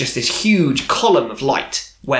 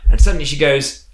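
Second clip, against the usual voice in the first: usual speaking voice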